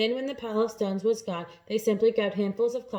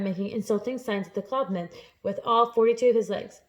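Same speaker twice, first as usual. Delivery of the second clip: ordinary delivery, nothing marked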